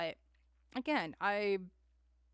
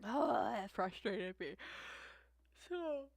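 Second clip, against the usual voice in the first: frustrated sigh; 8 dB quieter than usual. Real, not acted. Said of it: "I'm just so frustrated".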